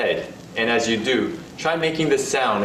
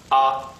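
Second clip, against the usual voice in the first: "ah" as a quick vocal tap; short level "ah"; neutral tone; 2 semitones higher than usual. Shown as a demonstration. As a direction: tap "ah" and stop. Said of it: "quickly tapping something with your voice".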